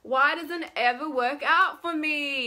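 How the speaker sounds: loud, high whining complaint; exaggerated pleading whine; really whiny. Shown as a demonstration. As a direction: whine it out, self-pitying. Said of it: "get really whiny".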